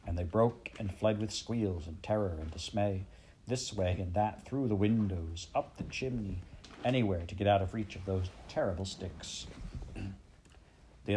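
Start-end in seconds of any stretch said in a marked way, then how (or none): none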